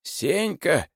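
high wailing call over the noise; wailing cry. A narrator's reading. Zimoveikin yelling over the noisy room, wailing. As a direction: cry out his name over the din. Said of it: "завопил в выступлении Зимовейкин".

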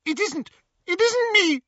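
very high, panicked squeal; terrified squeak. A narrator's reading. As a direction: squeak out a frantic denial in terror. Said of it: "Hermione's terrified squeak".

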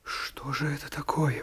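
a near-silent, involuntary murmur; half-aloud murmur. A narrator's reading. Alone in his room after seeing the window, murmuring. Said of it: "проговорил я вслух почти невольно".

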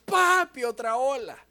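put-on a small girl alerting her dad; little daughter's voice. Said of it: "me dice". (voz de niña avisando)

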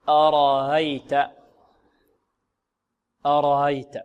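أرأيت drawn out, second hamza eased between hamza and alif; تسهيل الهمزة. Shown as a demonstration. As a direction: say أرأيت slowly with the second hamza softened into a glide. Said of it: "في رواية الإمام قالون نقول". (أرأيت بتسهيل الهمزة الثانية)

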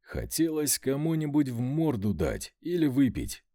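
usual speaking voice

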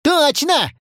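high, eager backing-up cry; high-pitched schoolboy protest; Миша Леший. Acted. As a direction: chime in as an indignant pupil. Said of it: "поддержал товарища Миша Леший".

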